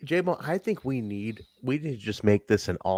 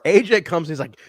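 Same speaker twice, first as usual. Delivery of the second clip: normal talking voice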